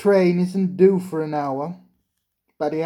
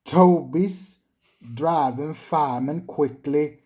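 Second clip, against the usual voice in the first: usual speaking voice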